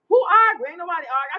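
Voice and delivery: high, loud outraged "who arguing?"; indignant disbelief. Real, not acted. Pushing back on a viewer's comment, loud and incredulous.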